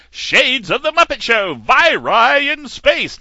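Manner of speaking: loud, high announcer boom; hammed-up TV announcer, drawn out; booming show-title announcement. Mimicking Pigs in Space announcer.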